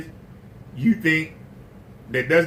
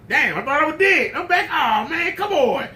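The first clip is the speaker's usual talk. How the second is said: high, whiny groan at being alive again; lightly voiced fed-up soul; a hint of a tortured man sighing; mock exasperated victim; 11 semitones higher than usual. Sufferer revives, exasperated.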